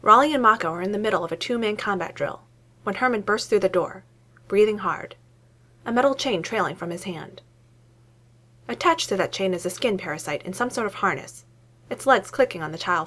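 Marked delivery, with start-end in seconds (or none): none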